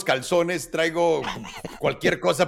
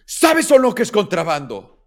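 pressing interrogation; 5 semitones higher, 8 dB louder than usual. Retold or imitated. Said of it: "Y me dice".

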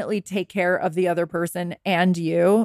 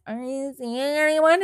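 probing question; 5 semitones higher than usual. Acted. Someone prying into a single person's love life, nosy. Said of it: "when someone says".

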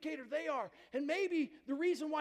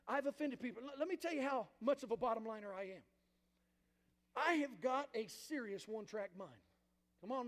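usual speaking voice

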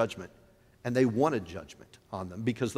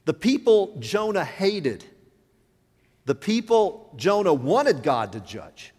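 slow, louder and higher preaching; preaching with force; 6 semitones higher, 5 dB louder, slower than usual. Real, not acted. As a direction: drive the point home to the congregation.

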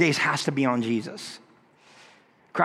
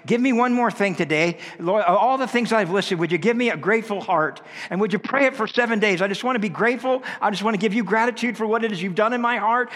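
earnest prayer; 4 semitones higher than usual. Shown as a demonstration. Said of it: "add that to your prayer list".

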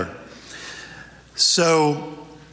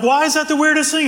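a much higher, quick, curious ladies' voice; puzzled ladies; 13 semitones higher, faster than usual. Acted. Ladies quoted in a joke.

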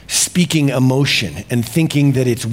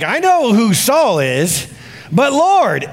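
alarmed exclamation; 6 semitones higher, slower than usual. Retold or imitated. Ananias protests to the Lord, high and alarmed. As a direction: pitch jumps up, alarmed and pleading. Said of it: "exclaimed Ananias".

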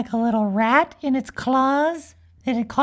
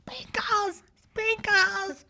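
high, soft faraway calls of a name; mock distant shouting; 8 semitones higher, 7 dB quieter, slower than usual. Teachers calling up to a runaway unicorn, mock shouting. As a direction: call out high, as if from far below. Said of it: "the teachers were shouting".

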